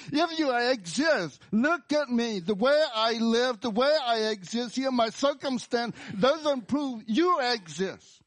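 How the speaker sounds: high, loud accusing cry; angry at God. Acted. Sufferer telling God their life disproves him, angry shout. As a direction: throw the challenge at heaven at full pitch. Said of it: "you bring out your anger at God and say".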